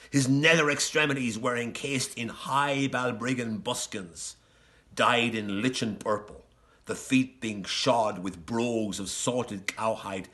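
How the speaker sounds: ordinary delivery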